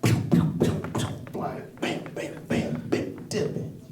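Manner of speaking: breathy rapid pew and bam noises; soft whooshing pews; whispered pew sound effects. Acted. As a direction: make the zipping sound of running.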